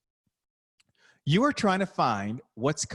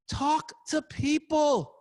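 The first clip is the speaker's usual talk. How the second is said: much higher, slow, every word of Talk to people hit hard; emphatic repeat; 14 semitones higher, much slower than usual. Shown as a demonstration. Coach urging shy coaches at the Final Four, slow emphatic push. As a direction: drive home Talk to people, slowly. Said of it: "not get out of their comfort zone to talk to people".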